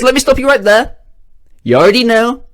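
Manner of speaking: high, exasperated cutting-in voice; impatient interruption. Acted. Man at the bar cutting off his friend, impatient and high. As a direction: wave the question away before it's asked.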